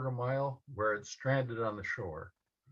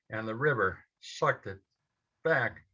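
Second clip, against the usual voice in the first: usual speaking voice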